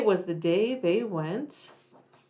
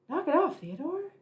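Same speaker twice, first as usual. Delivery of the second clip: scolding brother; 8 semitones higher, 3 dB quieter than usual. Acted. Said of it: "brother scolds Theodore".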